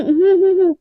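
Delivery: closed-mouth humming reply. Acted. Hiding cigarette smoke from the driver, muffled humming. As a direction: hum the answer without opening your mouth. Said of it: "मुँह खोल नहीं सकती क्या?".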